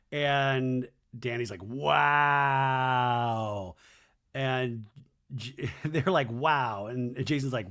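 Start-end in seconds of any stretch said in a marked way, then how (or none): 1.6-3.8 long, held-out "wow"; mock drunken wow. Danny answers Jesse's walk offer, mock drawn-out wow. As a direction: stretch the one word right out, imitating him.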